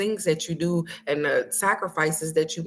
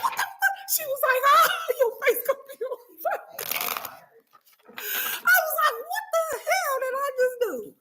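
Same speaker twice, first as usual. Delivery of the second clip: squeaky laughing retold panic; high laughing quotes; 3 dB quieter than usual.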